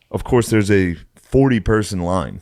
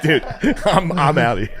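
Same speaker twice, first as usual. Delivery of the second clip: laughing exit; 7 semitones higher than usual. Retold or imitated. Quitting the line laughing.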